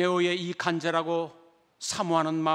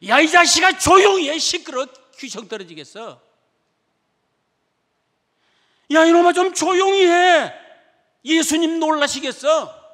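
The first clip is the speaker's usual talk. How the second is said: loud, higher rebuke; scolding crowd; 10 semitones higher, 12 dB louder than usual. Acted. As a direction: shut the beggar up harshly. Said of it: "꾸짖고 책망했습니다".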